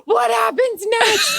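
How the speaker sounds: high, laughing cry of suspense; excited baited question. Retold or imitated. Wife hooked on husband's hockey story, laughing excited squeal. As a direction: squeal it out like you're on the edge of your seat. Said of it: "I'm like".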